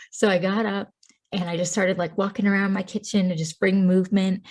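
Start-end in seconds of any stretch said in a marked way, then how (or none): none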